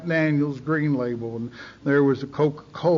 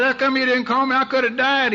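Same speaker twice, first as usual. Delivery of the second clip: high, plaintive mock whine; a half-joking wounded plea; mock hurt pleading; 9 semitones higher, 3 dB louder than usual. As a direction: play the wounded child, pitched up. Pleading with dad after he left.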